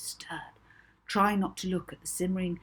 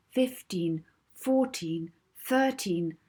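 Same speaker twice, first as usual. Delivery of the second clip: usual speaking voice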